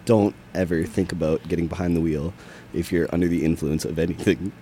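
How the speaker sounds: low, even cautionary radio read; serious public service announcement; solemn PSA warning. Acted. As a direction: warn listeners gravely in a low voice.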